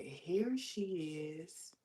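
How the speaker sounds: slow mimicked announcement; drawn-out greeting. Pantry lady greeting her in line, drawn-out. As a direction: stretch it out like a greeter.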